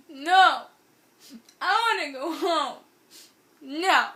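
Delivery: put-on high, loud toddler-like refusal; mock whiny yell. Friend refusing food at Jack in the Box, mock whiny yell. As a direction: wail the refusal, hammed-up.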